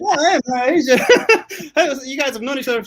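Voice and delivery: very high, giggly imitation; mock greeting through laughter. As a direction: laugh through the imagined hello. Imagining rapper's greeting, laughing.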